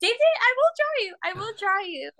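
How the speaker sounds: high, insistent mock placating; exasperated reassurance. Artist retells promising offended costar a drawing, high and insistent.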